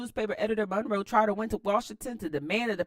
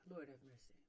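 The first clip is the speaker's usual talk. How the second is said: normal talking voice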